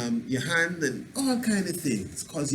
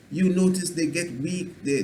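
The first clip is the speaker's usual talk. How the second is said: ordinary talk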